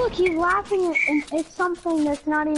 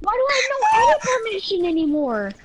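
higher, louder, fast whining shout; whiny outcry; 5 semitones higher, 4 dB louder, faster than usual. Real, not acted. Player who lost his edit rights complaining to the host, whiny shout. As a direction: cry out in outraged protest. Upset at losing edit permissions.

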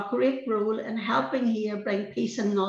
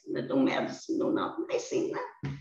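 hushed, near whisper; 11 semitones lower, 7 dB quieter than usual. Real, not acted. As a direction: voice drops to a soft, breathless hush. Recalling dying children in hospital.

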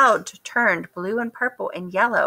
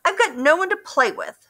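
lonely Duck; 9 semitones higher, faster than usual. A narrator's reading.

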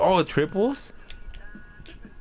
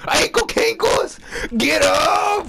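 high, loud hype yell at the screen; hype shout; 12 semitones higher, 4 dB louder, slower than usual. Real, not acted. Gamer yelling at his player after a crossover, hyped shout. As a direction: scream it like you just broke an ankle.

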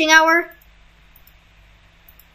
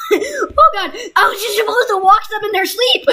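laughing disbelief; 8 semitones higher than usual. Real, not acted. Laughing shock at transformation.

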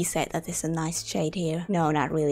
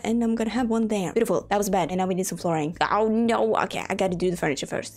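ordinary talk

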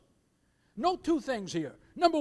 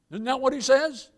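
normal talking voice

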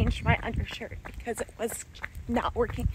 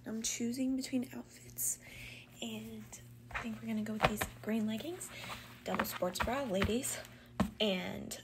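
whispering; 2 semitones lower, 12 dB quieter than usual. Real, not acted. Whispered outfit rundown.

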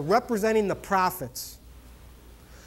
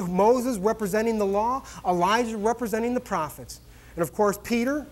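ordinary delivery

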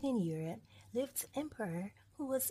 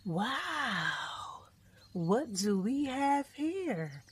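drawn-out, higher, louder lion voice; taunting lion; 4 semitones higher, 4 dB louder, much slower than usual. Acted. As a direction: stretch it out like a lion finding prey. Lion greets prey.

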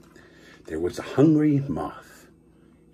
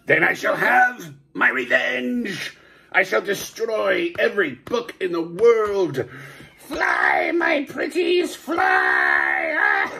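high shouted witch command; wicked witch voice; 4 semitones higher, slower than usual. Acted. Villain commands with evil voice.

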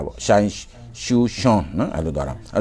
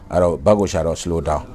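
usual speaking voice

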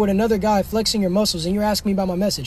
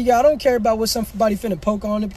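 ordinary delivery

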